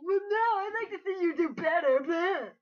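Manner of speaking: high, loud whiny retort; mocking defensive artist. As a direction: mimic a touchy artist snapping back. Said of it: "you started saying".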